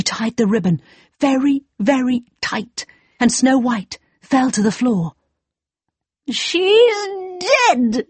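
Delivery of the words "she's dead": high, triumphant villain shriek; evil queen. Acted. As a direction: crow with wicked glee. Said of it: "cried the wicked queen".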